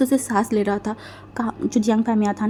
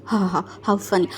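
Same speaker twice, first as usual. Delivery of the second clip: slow, softer mocking scoff; sarcastic scoff; 3 semitones lower, 5 dB quieter than usual. A narrator's reading. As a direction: pull a face and sneer it out slowly. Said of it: "मुंह बनाने बोला".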